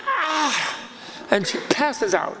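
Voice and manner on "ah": very high shocked "Ah!"; a startled cry before fainting; shocked shriek. Retold or imitated. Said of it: "And she's like".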